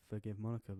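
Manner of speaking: ordinary delivery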